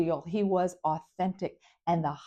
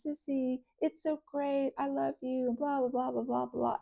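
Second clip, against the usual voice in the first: put-on higher, softer, sappy drunk voice; affectionate tipsy brother; 6 semitones higher, 6 dB quieter than usual. Tipsy brother gushing love to his sister, higher and softer. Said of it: "when he drinks, he gets very affectionate".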